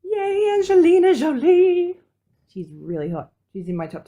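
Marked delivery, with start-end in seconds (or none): none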